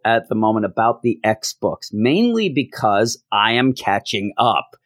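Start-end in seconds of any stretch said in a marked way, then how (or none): none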